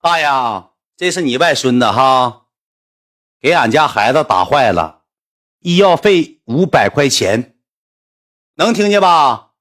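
put-on slow, deliberate demand to an old man; slow, firm and spaced out; talking to the deaf. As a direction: make each phrase land for someone hard of hearing. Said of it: "你看看还听不见".

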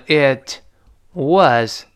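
"it", a gap, then a long clear "was"; slow reading. Shown as a demonstration. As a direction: separate "it" and "was" fully. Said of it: "读慢的时候读成".

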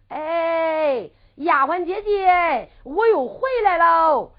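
慢悠悠拉长调子喊人; 陪着笑把人叫回来; 拖长声喊. Acted. 彭孝宗拖长声叫回丫鬟. (拖腔喊人)

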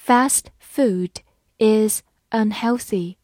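slow, every word clear; slow reading. Shown as a demonstration. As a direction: read it slowly for students to repeat. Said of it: "好，慢慢来读。".

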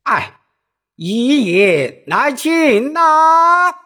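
high, slow opera cry; urgent opera call. Acted. Silang answers his brother urgently in a high, slow opera cry. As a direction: stretch the line out as an opera 叫板. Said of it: "四郎急切地答道".

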